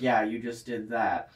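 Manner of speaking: slow, straight-faced imitation; deadpan, imitated. Audio engineer answering the happy artist, slow deadpan. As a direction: draw it out drily, unimpressed. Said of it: "a really straight face and is like".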